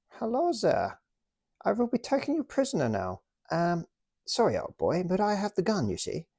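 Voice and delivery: high, jolly British officer taking a prisoner; British toy officer politely arresting the German, in a high jolly voice. Pretend. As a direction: play the smug officer with the gun, pitched high. Acted toy soldiers' standoff.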